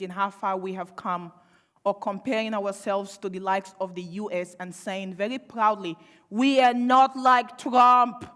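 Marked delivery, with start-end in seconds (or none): 6.3-8.3 slow, loud, drawn-out smug pride; mock proud boast. Mocking complacent Australians with a loud, slow, smug boast. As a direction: puff up and sneer like a smug patriot.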